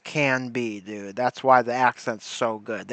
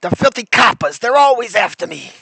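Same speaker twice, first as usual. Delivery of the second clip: hammed-up old movie gangster, pitched up and louder; mock gangster; 6 semitones higher, 4 dB louder, faster than usual. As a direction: sneer it like a cartoon crook. Dubbing the dying gangster mockingly.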